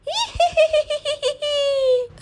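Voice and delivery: high, drawn-out evil cackle; witch's cackle. Acted. Witch returns behind Shyam. (चुड़ैल की ऊँची डरावनी हँसी)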